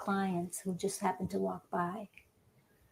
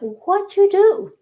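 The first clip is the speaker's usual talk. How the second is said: much higher, louder and slow, in mock astonishment; shocked friends; 12 semitones higher, 10 dB louder, slower than usual. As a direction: gasp it out like the friends seeing the new hair. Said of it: "all her girlfriends went".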